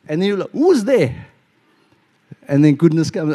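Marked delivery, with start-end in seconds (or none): 0.5-1.1 higher, mock-alarmed call; acted startled question. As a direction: call out as if spooked by a follower. Acting startled at being stalked.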